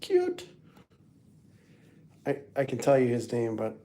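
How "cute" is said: tiny voice jumped way up high; high-pitched cooing; squeaky cute voice. Pretend. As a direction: squeal it like cooing at a baby animal. Showing a cute picture.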